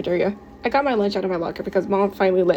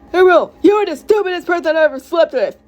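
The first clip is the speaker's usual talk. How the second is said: high, loud quarrelling yell; shrieking argument, loud and high; furious insult; 9 semitones higher, 5 dB louder than usual. Acted. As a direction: hurl the insult at a partner at full pitch. Mom screams insult at Xavier.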